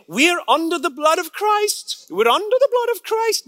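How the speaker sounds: sing-song it like a devout mom; mother's voice. Retold or imitated. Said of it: "She would say".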